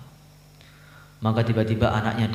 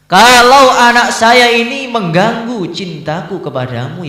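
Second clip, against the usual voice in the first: high, loud, anguished plea; fervent prayer; 11 semitones higher, 11 dB louder than usual. Acted. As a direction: cry out to God in desperate devotion. Ibrahim pleads in prayer.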